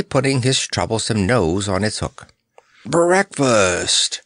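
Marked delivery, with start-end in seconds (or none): 2.8-4.2 high, wheezy lion's announcement; put-on wheeze, pitch lifted; wheezing. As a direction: wheeze the word out emphatically. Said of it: "wheezed the Cowardly Lion".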